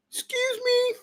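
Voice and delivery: very high, tiny falsetto apology; imitating the Walmart clerk. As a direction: squeak it out daintily like she did. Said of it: "Exactly like that".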